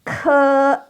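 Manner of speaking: Ke high and level with a puff of air; aspirated ke. Shown as a demonstration. Teacher modeling aspirated Ke for class. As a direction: breathe out hard on Ke. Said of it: "yang kedua keluar udara".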